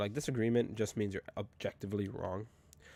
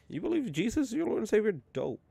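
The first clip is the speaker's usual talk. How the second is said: higher, playful take on faith talk; 12 semitones higher, 4 dB louder than usual. Real, not acted. Joking to his friend about faith, higher and playful. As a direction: say it up high with a grin.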